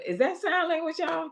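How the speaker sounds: amused high-pitched quip; high teasing question. Real, not acted. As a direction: tease them, voice jumping high. Host teasing silent guest's gestures.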